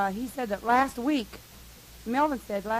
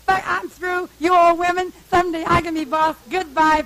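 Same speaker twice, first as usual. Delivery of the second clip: high, loud imitation of a fed-up tantrum; mock angry outburst; 7 semitones higher, 9 dB louder than usual. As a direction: storm off in a hammed-up huff. Imitates Tommy's angry outburst at women.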